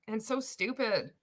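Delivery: ordinary talk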